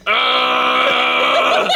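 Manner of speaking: long, high, half-hearted roar; drawn-out mock roar, weakly committed; half-assed angry roar. Mimicking the character's roar before his tackle, half-assed angry roar. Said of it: "like, the angry roar, but it's, like, really half-assed".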